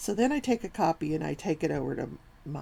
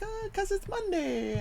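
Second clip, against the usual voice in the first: a high, slow mock "duh, obviously" voice; 14 semitones higher than usual. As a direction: imitate the coworkers stating the obvious, slowly. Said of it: "And they're like".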